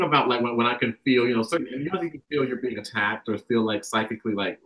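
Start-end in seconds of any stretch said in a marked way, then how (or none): none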